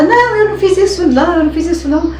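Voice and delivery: high, insistent imitated denial; re-enacted yell, starting high and loud then easing; retold yelling. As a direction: act out a man's shouted protest of innocence. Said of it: "Aí ele gritava".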